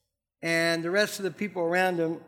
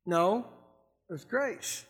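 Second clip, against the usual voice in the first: ordinary delivery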